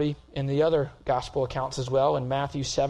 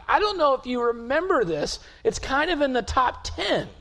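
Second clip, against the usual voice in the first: much higher and louder, pushing to the peak; impassioned preaching; 10 semitones higher, 6 dB louder than usual. Real, not acted. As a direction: preach it hard as Jesus confronts his accusers. Peak of sermon, Jesus voiced.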